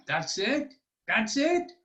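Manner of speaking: a king going from disbelief to outrage; rising disbelief. Acted. King's disbelief building to anger.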